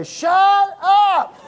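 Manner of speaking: high, loud mock yell at the family; retold yell. Dad yelling through the door at the family, loud. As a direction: belt it out, half laughing at yourself. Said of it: "I open up the door and I say".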